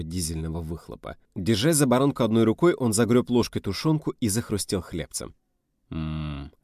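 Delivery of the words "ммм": low, soft mmm of pleasure; long, quiet appreciative mmm; savouring hum. Acted. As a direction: hum contentedly over tasty food. Savouring food while driving.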